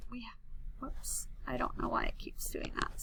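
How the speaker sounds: hushed whoops under the breath; whispered aside. Real, not acted. Teacher annoyed at the glitching screen, whispering. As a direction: mutter it quietly to yourself.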